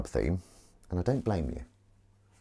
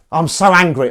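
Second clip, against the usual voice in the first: higher, louder mock fury; mock anger; 7 semitones higher, 11 dB louder than usual. Joking about the failed toy, mock angry. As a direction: ham up being furious. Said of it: "I'm not angry".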